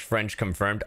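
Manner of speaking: ordinary talk, nothing marked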